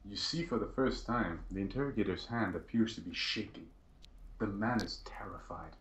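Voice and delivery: hushed, suspenseful scene-setting; game master's narration. Acted. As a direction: narrate softly, as if revealing hidden fear.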